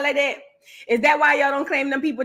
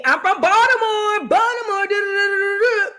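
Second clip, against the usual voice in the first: high-pitched impression announcing a hometown; Monique; 7 semitones higher than usual. Imitating a celebrity claiming Baltimore, high mock voice. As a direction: pitch it up high and run on into babbled syllables. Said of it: "if Monique never came out and said".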